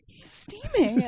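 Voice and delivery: high-pitched mock astonishment; amazed retelling. Telling Josh about the steaming instructor, amazed.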